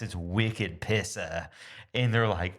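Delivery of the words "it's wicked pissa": slow put-on Boston "wicked pissa" ending in ah; mock Boston accent. Explaining the slang to his wife, slow mock Boston accent. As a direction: lean into the Boston vowels slowly. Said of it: "not Boston enough".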